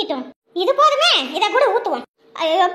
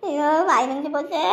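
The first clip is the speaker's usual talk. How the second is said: low, soft pained moan; 7 semitones lower, 4 dB quieter than usual. Acted. Wife moaning to the family about her burnt mouth, low and pained. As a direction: moan it out in pain.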